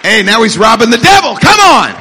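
loud, high exultant shout; rousing preacher shout. Real, not acted. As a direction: build to a full-force "Come on!". Preacher whipping up the congregation.

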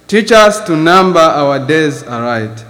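ordinary talk

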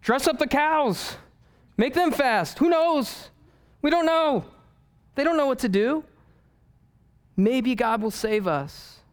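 high, fretful royal voice; a panicked king who has no idea what to do; flustered king of Nineveh. Acted. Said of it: "the king of Nineveh, he's like".

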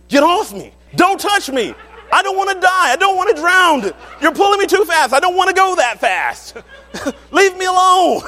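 high, loud panicked shouting at wife; panicked yelling. Retold or imitated. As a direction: yell in a frantic panic to be left alone. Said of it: "I just went off".

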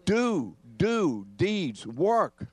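each word alone, high and punched; high, forceful beat on every word; emphatic hammering. Real, not acted. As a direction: hammer out do, do, deeds, work one by one. Drumming the answer into the congregation.

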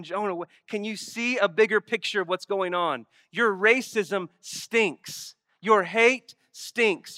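earnest God. Acted. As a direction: plead firmly, not angry. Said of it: "He's just saying".

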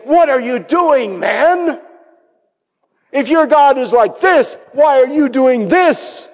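high, loud, disbelieving questioning; incredulous challenge. Acted. Sailors confronting Jonah about his God, loudly incredulous.

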